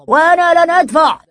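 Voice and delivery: loud high defiant shout. A narrator's reading. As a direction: yell it out. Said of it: "فصاح فتى آخر".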